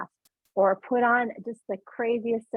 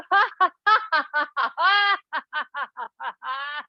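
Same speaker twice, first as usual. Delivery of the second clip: higher, open ha-ha-ha; free exercise laugh; 5 semitones higher, 3 dB louder than usual. Shown as a demonstration. Teacher letting it come out, free rolling laugh. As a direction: let the laughter pour out. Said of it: "Let it come out of you".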